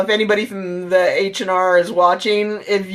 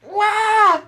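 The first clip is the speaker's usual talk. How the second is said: high cartoonish "wah" of losing it; mock freak-out wail; 12 semitones higher than usual. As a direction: imitate your own meltdown in one cry. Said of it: "Losing it".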